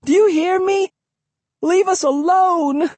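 high-pitched desperate cry; shrill, distressed girl's voice; pleading. A narrator's reading. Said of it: "Her pleas".

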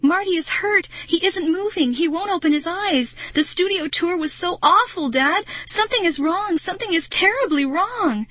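high-pitched, anxious outpouring; distressed pleading. A narrator's reading. Girl telling dad Marty is hurt, pleading. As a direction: plead in mounting alarm. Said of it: "I pleaded".

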